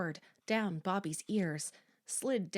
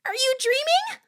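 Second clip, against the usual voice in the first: very high, loud, incredulous question; sharp, raised-voice demand; 10 dB louder than usual. A narrator's reading. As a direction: demand an answer over the screams. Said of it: "Kimmy demanded".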